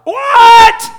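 loud, high cry of disbelief; shocked shout. Acted. Preacher feigning shock at Jesus's words, shouting.

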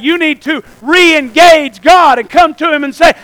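loud, high, urgent sermon delivery; preach. Real, not acted. Preacher urges people back to God, loud impassioned preaching. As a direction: press the congregation with full-voiced conviction.

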